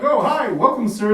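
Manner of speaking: high, sugary airport welcome; chirpy fake politeness; overly friendly staff. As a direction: airport staff greet a traveller, high and sweet. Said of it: "they're like".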